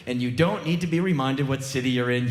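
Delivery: ordinary delivery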